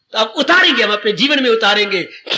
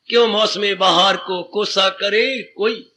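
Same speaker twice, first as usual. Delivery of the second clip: slow recited verse line; 4 semitones lower, slower than usual. Shown as a demonstration. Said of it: "repeats the couplet".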